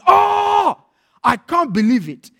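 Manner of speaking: high, loud acted outcry; shouting. Conned man shouting in his house, outraged. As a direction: wail the opening word loud and high. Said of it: "with a guy shouting".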